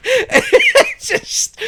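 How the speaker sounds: high, loud helpless laughter; remembering the scorpion chaos, laughing; laughing hard. Real, not acted. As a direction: crack up at the memory.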